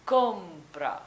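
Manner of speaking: "Compra" with a loud, drawn-out first syllable, COOM-pra; stress on the O. Shown as a demonstration. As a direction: lean hard on the O of "Compra". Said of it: "the emphasis is on the O".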